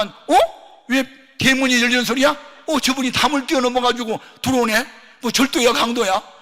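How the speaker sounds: loud, high alarmed cries; startled woman. Retold or imitated. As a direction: panic at an intruder. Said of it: "막 그러면서 놀랐는데". (담 넘어온 목사에 놀란 여자의 높고 큰 외침)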